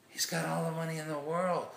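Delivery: put-on excited child. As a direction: retell a child's thrilled bragging. Ecstatic children boast about new dad.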